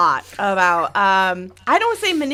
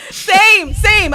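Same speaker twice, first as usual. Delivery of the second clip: excited; 10 semitones higher, 7 dB louder than usual. Real, not acted. Emphatic agreement.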